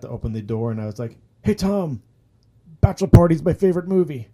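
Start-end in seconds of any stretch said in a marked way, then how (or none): 1.4-4.3 high, loud call after a departing star; acted-out fan outburst, pitched way up; starstruck blurt.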